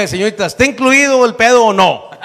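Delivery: loud, high-pitched question; hammed-up loud demand; mock exasperated demand. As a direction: call it out to the masseuse, exasperated. Acts out exasperated question to masseuse.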